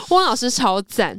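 normal talking voice

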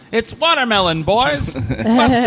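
high-pitched mock police call-out; raiding forensics cop. Host plays police finding watermelon liquor, hammed-up high call. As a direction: announce the find to the squad, hammed up.

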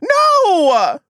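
shouted denial. Acted. Suspect yelling a denial at the detective. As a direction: shut it down with a shout.

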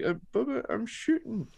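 high-pitched Southern voice, struggling to get words out; strained high Southern plea; Jim Cornette. Retold or imitated. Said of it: "in that sort of high-pitched Southern voice".

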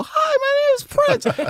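very high falsetto squeak; mock squeaky Prince. Teasing Prince with a high squeaky mock greeting. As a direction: squeak it up like a tiny cartoon voice. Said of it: "I thought you was gonna go".

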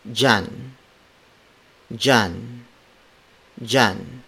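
"Jan" said as "jahn", starting with a J sound; DIY as J. Shown as a demonstration. Teacher showing how Filipinos say diyan as jan. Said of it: "D I Y combination is often pronounced ... like the letter J".

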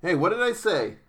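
sharp, high-pitched telling-off; real scolding of misbehaving pets. Real, not acted. Scolds pets making noise in room.

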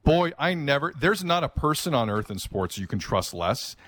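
normal talking voice

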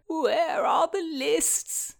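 high, drawn-out distressed moan; hammed-up plaintive moan. A narrator's reading. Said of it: "he moaned".

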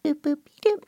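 high, light little boops; Betty Boop. Shown as a demonstration. Demonstrating a cartoon star to the interviewer, high playful Betty Boop voice. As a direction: squeak out tiny cartoon boops. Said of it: "there's no fry in Betty Boop".

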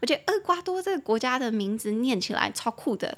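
usual speaking voice

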